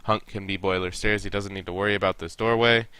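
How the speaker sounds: ordinary talk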